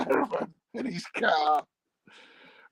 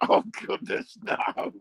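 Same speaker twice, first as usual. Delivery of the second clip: mock disgust; 8 semitones higher than usual. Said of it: "people are like".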